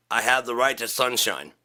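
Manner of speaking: usual speaking voice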